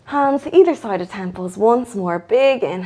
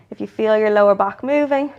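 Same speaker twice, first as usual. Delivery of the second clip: normal talking voice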